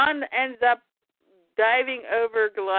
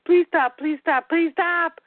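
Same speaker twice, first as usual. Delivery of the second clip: mock pleading; 6 semitones higher, 4 dB louder than usual. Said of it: "and goes".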